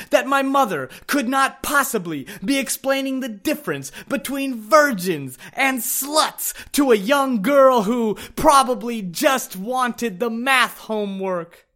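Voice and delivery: normal talking voice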